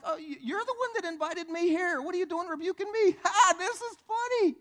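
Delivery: high, loud incredulous mockery; jeer, hammed-up, with a big forced laugh; Satan. Devil asking believer why rebuke him, high gleeful mockery. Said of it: "He's like".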